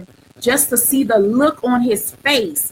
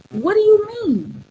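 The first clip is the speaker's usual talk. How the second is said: puzzled; 9 semitones higher than usual. Retold or imitated. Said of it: "I looked at him like".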